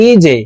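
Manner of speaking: "ইজে" with jim pushed down into a short echo; qalqalah jim. Shown as a demonstration. Teacher drilling qalqalah letters, bouncing jim. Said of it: "ধাক্কা দিয়ে উচ্চারণ করব".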